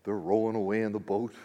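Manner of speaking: ordinary talk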